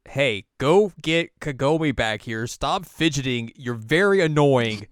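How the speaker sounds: loud, slow, emphatic orders; mock scolding. Sango scolds Inuyasha.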